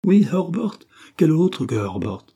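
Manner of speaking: loud, high "Herbert" thrown back in anger; angry exclamation. A narrator's reading. Confessing woman answering William's query, "Herbert" exclaimed angrily. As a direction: confirm "Herbert" forcefully, in a fit of temper. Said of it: "s'écria-t-elle en retombant dans un accès de colère".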